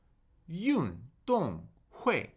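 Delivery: "运动会" slow, three sharp falls; three falling tones. Shown as a demonstration. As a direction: hit each syllable of "运动会" falling. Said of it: "Three fourth tones".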